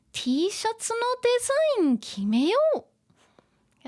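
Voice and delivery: put-on high, lively anime girl; animated Japanese dialogue, high and punchy; Nijika-chan. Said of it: "Nijika-chan says".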